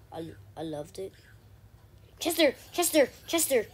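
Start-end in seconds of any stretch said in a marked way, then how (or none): 2.2-3.7 high, loud repeated yell; excited calling out. Real, not acted. Player spotting Chester in the game, shouting excitedly. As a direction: shout the name in quick bursts. Yelling a game character's name.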